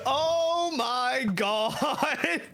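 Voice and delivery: drawn-out shout of amazement. Real, not acted. Louder drawn-out second take.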